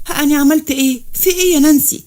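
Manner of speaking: high-pitched, bewildered protest; acted alarm. Girl threatened by officer asking her friend what happened, alarmed. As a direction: plead innocence in rising panic.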